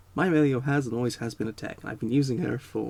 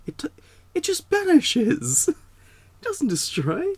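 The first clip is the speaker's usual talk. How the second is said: exasperated judge; 5 dB louder than usual. Pretend. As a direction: drag it out like a judge who has had enough. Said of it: "they didn't say it like that, but you kind of get, like, how I want him to have said it".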